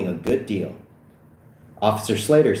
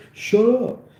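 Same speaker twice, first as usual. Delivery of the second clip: high-pitched snap; mock-angry high command; insistent retort; 14 semitones higher, 3 dB louder than usual. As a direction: cut them off, pitched high. Acted retort repeated.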